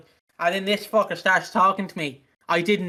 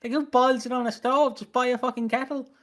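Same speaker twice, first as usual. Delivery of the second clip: mock exasperated agreement; 7 semitones higher than usual. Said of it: "And I'm like".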